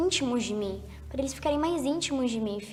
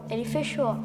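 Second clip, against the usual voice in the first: ordinary talk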